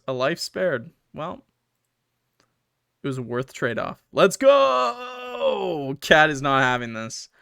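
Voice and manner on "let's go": cheer. Real, not acted. Cheering a finished ending.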